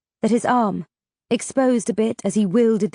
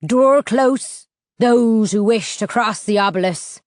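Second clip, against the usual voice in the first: low throaty rumble like an attack dog; throaty canine rumble; 4 dB louder than usual. A narrator's reading. Ferryman inviting travellers to cross, in a throaty rumble. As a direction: growl it like a guard dog. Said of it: "in a voice that sounded like the low, throaty rumble of an attack canine".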